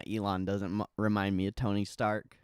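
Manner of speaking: ordinary talk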